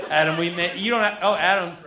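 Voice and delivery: ordinary delivery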